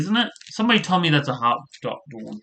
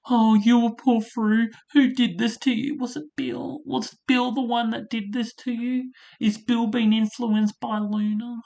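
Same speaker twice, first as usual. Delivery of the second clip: mocking Steffi; 8 semitones higher, 5 dB quieter than usual. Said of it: "just her tears with Liam, it's like".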